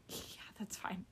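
hushed, breathless whisper. Retold or imitated. Client agreeing to leave the tense salon, whispered. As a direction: whisper it so nobody hears.

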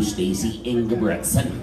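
normal talking voice

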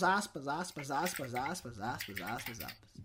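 fading repetition. Acted. Repeating aspas, fading out.

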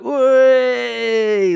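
loud high crowd jeer; mock crowd heckle shout. Crowd booing the presenter.

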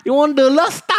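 very high, loud pushy waiter voice; high waiter caricature. Retold or imitated. Waiter pushing lobster on diners, very high voice. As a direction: squeak the offer through laughter.

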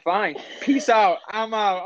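mock huffy exit. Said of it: "I'm like".

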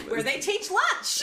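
high, slow, laughing mock principal; Snyder follow-up line. Finishing a Snyder quote, laughing high mock principal. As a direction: tack on the punchline with a laugh. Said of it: "good follow-up too".

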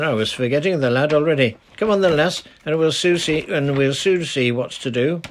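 put-on easy, friendly old dialect voice; lightly voiced old charcoal burner greeting a girl. Old man sets off.

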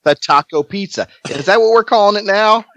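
high, teasing repeat of the name; mocking disbelief. Real, not acted. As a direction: needle him about the name in a high voice. Teasing the taco pizza name.

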